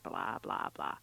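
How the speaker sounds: mumbled bored brush-off; bored blah blah. Real, not acted. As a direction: dismiss the rest as dull filler. Skimming a boring announcement.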